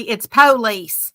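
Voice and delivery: "police" said slowly as PO-lice, stress on the first syllable; regional police. Shown as a demonstration. Telling Mister Corey how he says police, PO-lice. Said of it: "I don't know how y'all say it".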